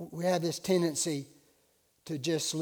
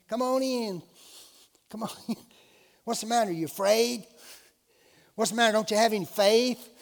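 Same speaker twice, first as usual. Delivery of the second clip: imitating teens coaxing him, higher pitched; mock pleading, pitched up to needle a friend; goading youths; 5 semitones higher, 3 dB louder than usual. Youths at the beach coaxing him into the water, mock goading. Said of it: "guess what the youth are saying".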